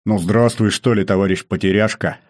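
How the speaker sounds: a friendly, booming general's greeting; good-natured bass. A narrator's reading. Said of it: "добродушно пробасил".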